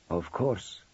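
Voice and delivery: put-on low, soft Romanian-accented reply. A narrator's reading. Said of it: "still trying to place the accent".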